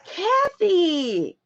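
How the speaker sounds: high falling cry of the name; high-pitched exclamation; exclaimed name. Real, not acted. Calling out to generous customer.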